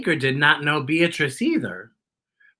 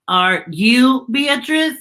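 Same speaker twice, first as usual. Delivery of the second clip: slow, higher robot question; inquiring robot Z; 7 semitones higher, 4 dB louder, slower than usual. Acted. Robot asking a turtle if it is Beatrice, slow and mechanical. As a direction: ask it slowly, pitched up, like a robot.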